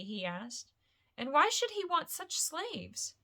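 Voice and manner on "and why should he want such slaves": lightly voiced Frodo.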